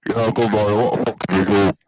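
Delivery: ordinary talk, nothing marked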